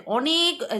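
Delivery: ordinary talk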